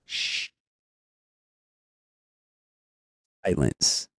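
long shush, a pause, then a low, soft word; drawn-out suspenseful reveal; theatrical hush. Shown as a demonstration. Teases topic with drawn-out shush.

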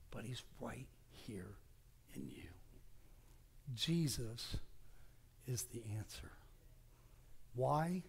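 almost whispered conviction; quiet reverent ending; hushed closing. Acted. Closing the sermon.

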